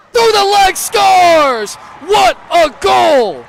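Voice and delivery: screaming the goal, very high; stretched, roaring goal call; goal call shout. Real, not acted.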